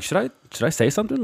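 high, unsure director voice; puzzled director. Retold or imitated. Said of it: "The director's like".

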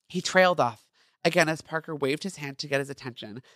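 normal talking voice

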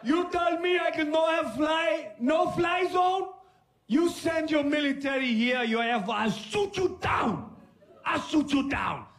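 put-on high shouting accented dictator; Gaddafi impression. Gaddafi threatening America, high shouting accented voice. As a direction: bellow defiance like a dictator. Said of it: "He was the first one that was like".

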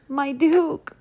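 high-pitched imploring appeal; pleading. A narrator's reading. Baker begging the Duke, pleading. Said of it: "pleaded Walter".

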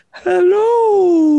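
high, loud wailing ghost. Acted. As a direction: moan it like a ghost. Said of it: "That's his ghost voice".

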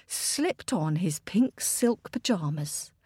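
plain reading voice

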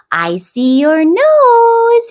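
very high, slow, loud sing-song; sing-songy parentese. Shown as a demonstration. Parent showing good baby talk, high sing-songy parentese. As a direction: coo it to a baby in long, climbing notes. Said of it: "it's that sing-songy voice".

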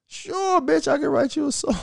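very high mock-flirty croon.

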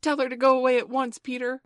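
loud, high, fast outburst; children crying out in alarm; urgent cry. A narrator's reading. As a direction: cry it out urgently, rushing the words. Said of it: "all three cried simultaneously".

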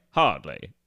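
low, suave British Bond voice; Roger Moore accent. Pretend. [name] putting on a Roger Moore accent for his therapist. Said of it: "when you do the accent".

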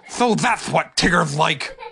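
slow, satisfied smiling voice; contented Tigger. Acted. Tigger finding his food, slow and contented. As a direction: savour the discovery. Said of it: "peaceful smile came over his face".

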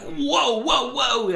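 high, drawn-out mock alarm; alarmed whoa. Sitting behind the angry drunk guy, mock alarm. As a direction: pitch the whoas way up, stretched out. Watching the man get furious.

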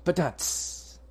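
spoken drum sting after a pun; mouthed rimshot. Pretend. Game master answers a player's pun, mock rimshot. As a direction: imitate a comedy drum hit.

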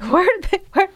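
can't believe they appeared from nowhere; retelling shocked confusion; bewildered outburst. Retold or imitated. Retold confusion at sudden brawl.

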